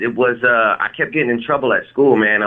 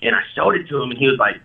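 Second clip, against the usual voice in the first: ordinary talk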